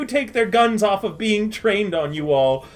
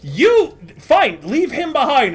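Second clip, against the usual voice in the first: ordinary talk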